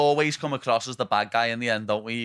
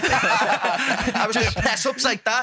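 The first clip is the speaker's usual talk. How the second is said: mock tough kid; 10 semitones higher than usual. Acting out younger self vowing revenge.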